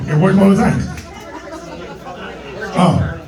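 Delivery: usual speaking voice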